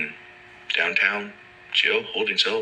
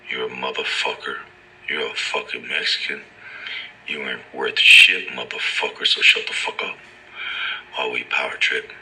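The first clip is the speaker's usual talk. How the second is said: lowered, menacing cop voice; low mock cop; 7 semitones lower than usual. Officers sneering at the detained man, low mock cop voice. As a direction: growl it as the abusive officer.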